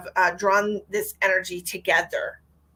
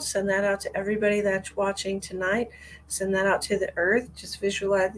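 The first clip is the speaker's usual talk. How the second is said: guided meditation. Acted. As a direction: guide listeners gently into their heart. Leads a short love meditation.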